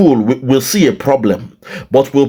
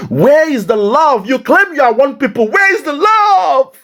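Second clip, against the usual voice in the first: anguished cry; 11 semitones higher than usual. Retold or imitated. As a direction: cry it out to the sky in disbelief. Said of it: "I'm saying".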